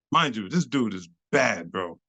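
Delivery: normal talking voice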